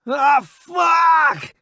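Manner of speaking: high loud pained cry; scream of pain. Acted. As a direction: cry out from the wound. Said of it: "he screams".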